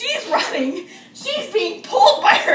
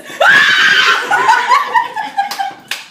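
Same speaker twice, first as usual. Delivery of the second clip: high shrieking laughter; 4 dB louder than usual. Retold or imitated. Acting out the girl's reaction, a high laughing shriek.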